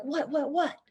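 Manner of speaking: high, soft imitated kids' questions; frantic asking. Kids behind who missed the owl, imitated frantic asking.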